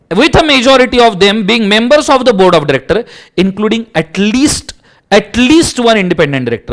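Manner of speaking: teacher reading law aloud; slow legal read. Acted. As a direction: read the clause out slowly and deliberately. Reading statute text.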